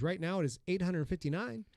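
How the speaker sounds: ordinary talk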